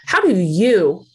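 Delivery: ordinary talk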